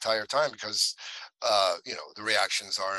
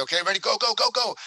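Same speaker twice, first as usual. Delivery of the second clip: rising shout, much higher and louder; calling out go; 11 semitones higher, 6 dB louder than usual. Retold or imitated. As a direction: yell go to start the run. Crew calls go.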